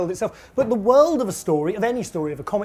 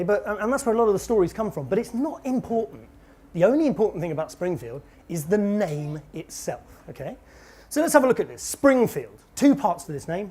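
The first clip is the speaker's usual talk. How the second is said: ordinary talk, nothing marked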